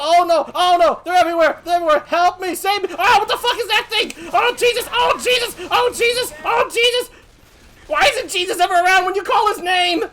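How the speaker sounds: high, loud frantic cries; panicked pleading. Acted. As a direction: beg for rescue at full pitch. Surrounded, calls for help.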